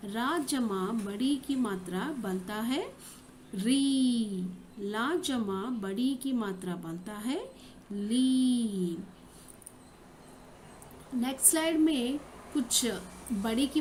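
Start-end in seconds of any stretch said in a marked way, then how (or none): 3.6-4.5 "री" with the ī held long and steady; long ī sound. Shown as a demonstration. Teacher showing children the ī matra, long drawn-out "री". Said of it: "ई ध्वनि के साथ होता है". 7.9-9.0 "ली" with the ī held long and steady; long ī sound. Shown as a demonstration. Teacher showing children the ī matra, long drawn-out "ली". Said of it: "ई ध्वनि के साथ होता है".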